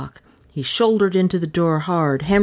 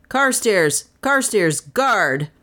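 higher, urgent command to the dog; calling a command; 5 semitones higher, slower than usual. Acted. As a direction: shout the dog's name, then order guard.

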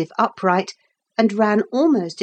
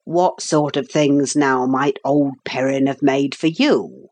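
lower, coaxing workman's voice; coaxing old carpenter; 4 semitones lower than usual. Acted. Carpenter prompting the boy to remember, coaxing. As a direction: lead the boy on in a rustic voice.

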